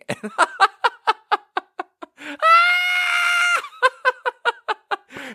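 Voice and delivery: long squealing cackle; real helpless shrieking laugh; hysterical laughter. Real, not acted. Cracking up at a coworker's prank, squealing laughter.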